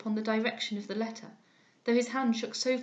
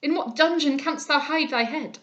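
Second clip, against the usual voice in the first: loud despairing lament; father thrown on the ground, loud; anguished cry; 4 semitones higher, 8 dB louder than usual. A narrator's reading. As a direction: rail against himself. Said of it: "cried he".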